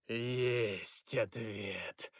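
slow, low gurgling spirit. Acted. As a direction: gurgle it out menacingly. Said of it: "воскликнул Носос".

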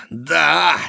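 high, loud enraged cry; howling. A narrator's reading. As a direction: howl it back in rage. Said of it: "взвыл Монсоро". (яростный громкий выкрик)

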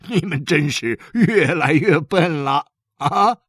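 teasing father. Acted. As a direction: tease the kids with amused exasperation. Father teases sons, laughing.

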